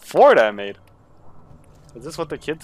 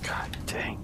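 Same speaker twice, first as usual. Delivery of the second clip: muttered under breath; whispered curse; 3 semitones lower, 13 dB quieter than usual. Real, not acted. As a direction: hiss it to yourself.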